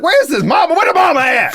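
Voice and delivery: loud, high calling out for the mother; exasperated shout. Retold or imitated. Host shouts in disbelief at the chaotic rental counter. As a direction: yell looking for the missing mom. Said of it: "I'm like".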